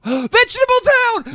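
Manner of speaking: gasping, high excited exclaim; excited realization. Real, not acted. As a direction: blurt it out in sudden delight. Sudden realization after gasp.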